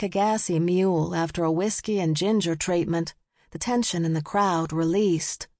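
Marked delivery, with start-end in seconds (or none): none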